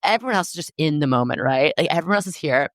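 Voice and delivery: a retold snap at herself, played light; mock self-scolding. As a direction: scold yourself in a rush, half-joking. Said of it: "I really, like, got mad".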